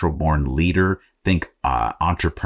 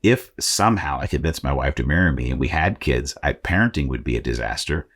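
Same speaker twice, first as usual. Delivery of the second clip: ordinary delivery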